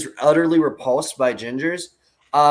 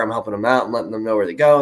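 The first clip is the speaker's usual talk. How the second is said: normal talking voice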